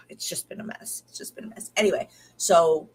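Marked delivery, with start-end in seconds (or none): 0.1-1.0 breathy hushed whisper, much softer; whispered confession to the camera. Real, not acted. Repeating the mess, hushed. 1.1-1.7 barely audible whispered echo; even quieter whispered repeat; fainter whisper. Real, not acted. Echoing itself, fainter.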